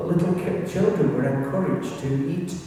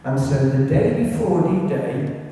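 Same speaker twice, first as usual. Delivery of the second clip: usual speaking voice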